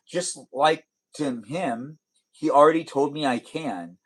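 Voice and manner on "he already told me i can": ordinary delivery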